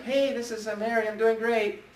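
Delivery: high, chirpy mock greeting; cheerful agent. Listing agent answering the phone, imitated as high and cheery. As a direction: imitate a bubbly agent on a great day. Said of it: "they'd be like".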